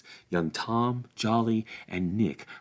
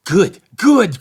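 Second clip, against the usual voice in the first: "Good, good" called out higher and loud; eager cry; 10 semitones higher, 13 dB louder than usual. A narrator's reading. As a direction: cry out, glad the fight has come. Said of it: "cried Farmer Cotton".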